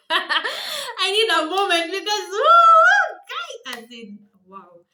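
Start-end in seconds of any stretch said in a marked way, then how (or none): 0.1-3.8 loud, high, slow laugh-cry; half laughing, half crying; a loud shrieking woo while laughing; laughing through tears. Real, not acted.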